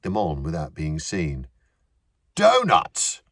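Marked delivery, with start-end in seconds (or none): 2.3-3.2 high, loud cry of Donuts; delighted shout. A narrator's reading. Older actor spotting the donuts, shouting with delight. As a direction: yelp it out with glee. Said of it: "an older actor said".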